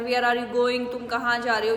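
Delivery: usual speaking voice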